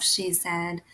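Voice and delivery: ordinary delivery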